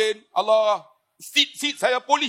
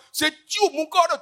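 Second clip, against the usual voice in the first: normal talking voice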